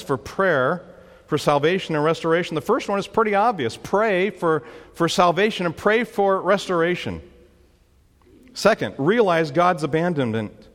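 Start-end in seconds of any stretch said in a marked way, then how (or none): none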